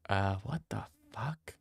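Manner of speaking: whispered "what the fuck"; hushed, baffled aside; mock confused whisper. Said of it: "They're like".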